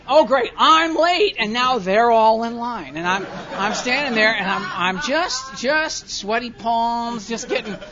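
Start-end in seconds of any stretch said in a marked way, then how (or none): 0.1-3.0 exasperated outburst. Retold or imitated. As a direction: burst out in annoyed disbelief. Inner rant in coffee line.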